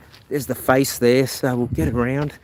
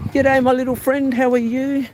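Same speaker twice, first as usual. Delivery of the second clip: very high, cooing pet voice; baby talk to dog; 12 semitones higher than usual. Acted. Talking to the sausage dog in sweet baby talk. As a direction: coo up high at the little dog.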